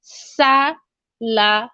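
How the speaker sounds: salamat broken up slowly, sa, la, mat; syllable by syllable. Shown as a demonstration. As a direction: draw out each syllable of salamat. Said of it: "number three is salamat".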